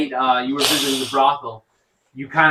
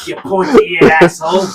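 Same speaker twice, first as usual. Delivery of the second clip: mock insult; 4 semitones higher, 7 dB louder than usual. Dwarf insults the elf.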